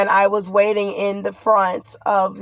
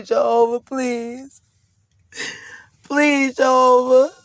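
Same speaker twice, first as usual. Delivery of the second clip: pleading prayer; 5 semitones higher, slower than usual. Real, not acted.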